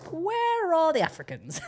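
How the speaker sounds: comic high-pitched disbelief; mock dismayed cry. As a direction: call out plaintively for your missing crowd. Mock searching cry, cheering for Nigerian.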